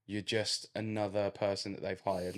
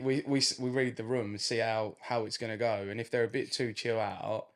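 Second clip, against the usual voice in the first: ordinary delivery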